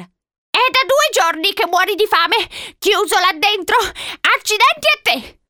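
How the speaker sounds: put-on high, exasperated old-woman outburst; panting. A narrator's reading. Old woman cursing the boy shut inside, panting. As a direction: curse him as if short of breath. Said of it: "ansimò la donna".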